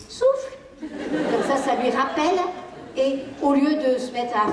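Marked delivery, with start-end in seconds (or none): 0.1-0.6 a casual nudge in passing; gentle reminder. Shown as a demonstration. Parent passing the child's collapsed tower, gentle prompt. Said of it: "vous lui dites".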